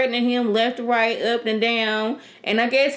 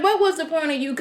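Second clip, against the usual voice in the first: higher, incredulous demand; exasperated; 7 semitones higher, faster than usual. Retold or imitated. Reacting to the toy-gun twist, exasperated and higher. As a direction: act baffled at the pointless scene. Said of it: "I was like".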